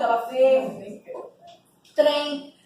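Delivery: usual speaking voice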